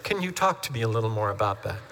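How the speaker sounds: soft professional counselor prompt; calm counselor voice. Shown as a demonstration. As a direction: ease into the question like a calm therapist. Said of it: "Good counselors say".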